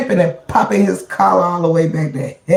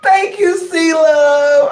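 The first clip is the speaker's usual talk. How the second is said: high, teary laughing thanks; a squeaky giggled thank you; 7 semitones higher, much slower than usual. Real, not acted. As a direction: thank them while still laughing. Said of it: "laugh till I teared up".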